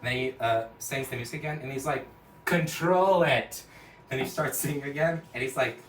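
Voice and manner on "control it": slow, loud, high-pitched order; exasperated cartoon order; loud command. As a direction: demand it one word at a time. Friend orders him.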